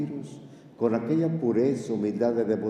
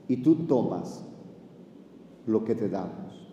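ordinary talk, nothing marked

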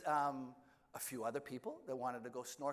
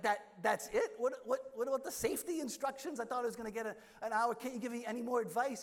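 higher, louder alarmed questions; panicky protest; 7 semitones higher, 6 dB louder than usual. Retold or imitated. Nervous snorkeler pleading with the captain, higher and louder. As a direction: scramble for more instructions in a mild panic. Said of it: "I'm like".

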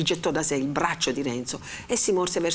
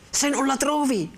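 stern and expectant; 9 semitones higher, 3 dB louder than usual. A narrator's reading. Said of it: "con un'aria di serietà ed aspettativa".

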